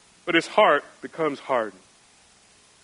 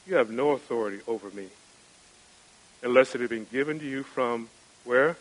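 low and much softer, calm and certain; quiet voice of Jesus; 6 semitones lower, 7 dB quieter, faster than usual. Acted. Preacher voicing Jesus to Pilate, low and hushed. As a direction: answer Pilate hushed and steady, no need to raise it.

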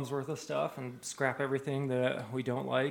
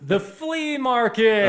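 loud, high, slow punchline; punchline shout; 9 semitones higher, 8 dB louder, slower than usual. Shown as a demonstration. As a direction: sell the joke's payoff. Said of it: "Where should you never take dogs shopping?".

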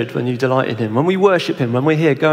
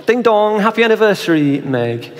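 higher, brighter, quicker doorstep greeting; cheery greeting; 6 semitones higher than usual. Acted. Husband at the door greeting his wife, cheerful and quick. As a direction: burst in happy with the flowers.

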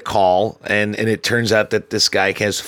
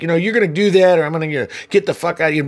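quick, higher, heated threat; fast threat; 8 semitones higher, much faster than usual. Retold or imitated. Supervisor threatening the litterer, fast and heated. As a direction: rattle off the threat.

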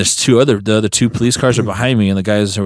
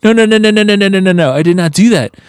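high, louder frantic no's; panicked denial; 10 semitones higher, 3 dB louder than usual. Retold or imitated. Driver frantically denying the shooting to police. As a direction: deny it in a laughing panic.

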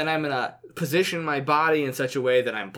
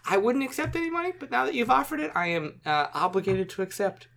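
higher, with feigned reluctance; mock-pompous herald; 7 semitones higher than usual. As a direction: sly herald pretending to be noble. Herald haggles in character.

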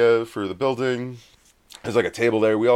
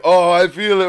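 raised, louder mock amazement; sudden high realization; 7 semitones higher, 6 dB louder than usual. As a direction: play the friend suddenly feeling it hit. Acting out friend getting high.

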